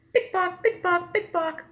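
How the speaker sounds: high clicking pick pock; high heels clicking. A narrator's reading. Teacher imitates the lady's high heels, high clicks. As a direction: tap it out like heels on pavement. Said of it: "the lady's high heels go".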